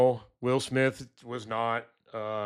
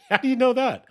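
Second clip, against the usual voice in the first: high, laughing disbelief; imitating astonished neighbor; 13 semitones higher, 3 dB louder than usual. Neighbor asking how he knew, high laughing surprise. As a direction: play the neighbor floored and laughing. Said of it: "and he's like".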